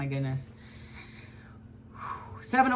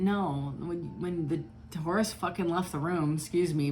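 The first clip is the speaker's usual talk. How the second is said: ordinary talk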